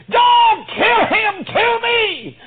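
high, loud, slow desperate cry; pleading shout. Retold or imitated. Judah begging the governor to spare Benjamin, shouted plea. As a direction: beg for his brother's life at full voice. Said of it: "Judah pleaded and said".

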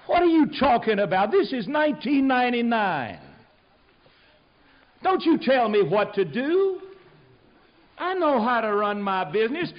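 high, loud, indignant son talking back; angry son. Acted. Son yelling back at his father, angry and defiant.

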